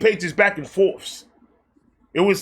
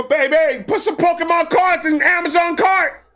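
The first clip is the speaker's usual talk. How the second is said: excited call; 9 semitones higher than usual. Acted. Calling to his partner to order cards, high excited shout. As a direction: yell it across the house like a giddy kid.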